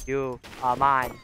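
slow, drawn-out gamer taunt; slow teasing threat to the enemy. Acted. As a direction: lean on each word, slowly. Taunting an opponent.

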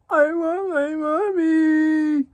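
put-on high slow whimpering child; scared little kid voice. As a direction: whine it like a scared four-year-old. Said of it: "He said just like".

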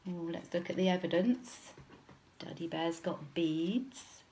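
ordinary delivery, nothing marked

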